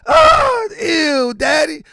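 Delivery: put-on high, loud whining five-year-old; mock whiny child. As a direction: whine like a kid refusing his dinner. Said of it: "My, my son was like".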